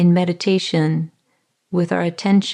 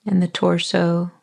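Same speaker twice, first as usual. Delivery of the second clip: calm body-scan voice with long pauses; guided meditation. Acted. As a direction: ease the listener into their body, unhurried.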